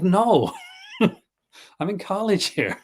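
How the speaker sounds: high laughing disbelief; laughing retort. Retold or imitated. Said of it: "I'm like".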